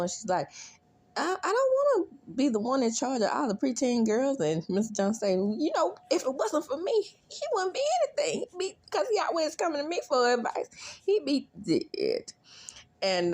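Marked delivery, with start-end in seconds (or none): 1.2-4.4 high whiny complaint. Retold or imitated. Voicing Sheminta's complaint. 5.3-12.3 very high, fast boast, slowing down at the end; squeaky bragging co-worker. Retold or imitated. Voicing Mister Jones bragging.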